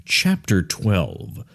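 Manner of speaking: very slow, low audiobook narrator; Jeff Riggenbach. Acted. Host imitating an audiobook reader of Mises, slow, deep narrator voice. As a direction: intone it slowly and low, like a chapter heading. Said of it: "You want Mises to sound like Jeff Riggenbach".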